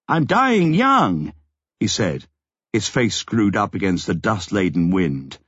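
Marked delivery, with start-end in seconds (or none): 0.1-1.3 slow, high, raised reply. A narrator's reading. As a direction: call it slowly over the wind. Answer shouted over the quake.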